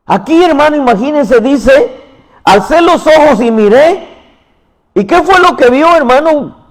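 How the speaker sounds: high and loud, building toward the vision; excited preaching. Acted. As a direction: lift it up with wonder.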